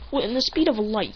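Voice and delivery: ordinary delivery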